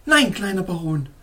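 a sharp exclamation; exclaimed. A narrator's reading. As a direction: cry out no to the baron. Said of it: "rief sie aus".

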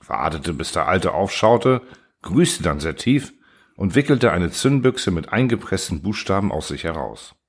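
plain reading voice

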